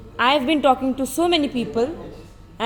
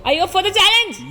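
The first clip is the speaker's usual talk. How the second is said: hype-man energy; 6 semitones higher, 4 dB louder, faster than usual. Shown as a demonstration. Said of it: "So slow".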